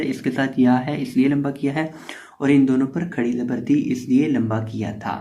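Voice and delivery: ordinary delivery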